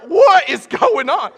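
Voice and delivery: loud, high, drawn-out bewilderment; comic panic. Retold or imitated. Lost student watching the board, comic panic. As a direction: panic loudly, stretching out the words. Acting out his panic in class.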